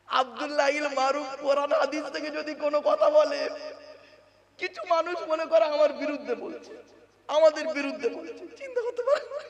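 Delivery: loud, driving sermon delivery; preaching at full force. Acted. Preacher pressing the congregation, full-force preaching. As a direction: preach it hard to the crowd.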